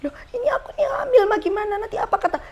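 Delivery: high-pitched mock-crying whine; tearful wail. Pregnant daughter appeals to mother, acted tearful wail. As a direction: whimper it like a panicking daughter, voice way up.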